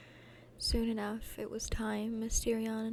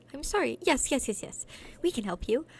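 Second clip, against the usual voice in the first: flustered eager apology; 2 semitones higher, 5 dB louder than usual. Acted.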